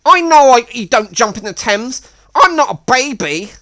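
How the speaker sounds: mock a man-child snapping back defensively; defensive Miles. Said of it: "And he was like".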